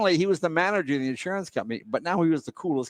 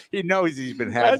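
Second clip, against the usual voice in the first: ordinary delivery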